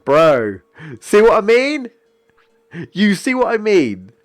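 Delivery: high, loud disbelieving outburst; exasperated shout. Real, not acted. Streamer yelling at the game, high and loud. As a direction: yell at the screen in disbelief.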